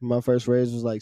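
ordinary talk

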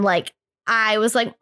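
ordinary delivery

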